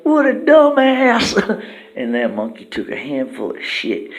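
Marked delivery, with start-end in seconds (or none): 0.0-1.6 high, loud, slow squeaky sneer; Turd sneering. Acted. Turd insulting the monkey, high, loud and slow. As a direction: drag out the insult like a cartoon. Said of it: "Turd's like".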